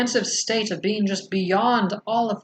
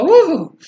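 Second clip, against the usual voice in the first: mock whoop; 10 semitones higher, 11 dB louder than usual. Mocks the chaotic mumbo jumbo.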